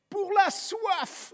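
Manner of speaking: put-on high-pitched, drawn-out boyish quip; Gavroche. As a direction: toss it off slowly in an urchin's high voice. Gavroche quips, pocketing powder flask.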